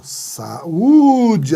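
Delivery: Saúde split, with a loud, long Ú; hiatus shown. Shown as a demonstration. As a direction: break after "Sa", then push the "ú" of Saúde. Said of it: "Saúde é um hiato".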